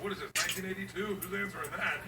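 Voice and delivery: quick annoyed mutter; fast annoyed. Retold or imitated. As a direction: rattle it off, annoyed. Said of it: "I go".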